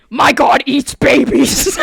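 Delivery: slow, loud mock-demonic voice. Mom twisting the church sign into a joke, demonic voice. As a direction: boom it out slowly like a demon. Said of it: "with, like, the demonic voice in it".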